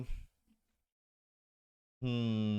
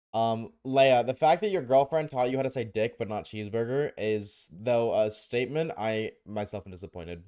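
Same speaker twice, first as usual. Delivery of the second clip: usual speaking voice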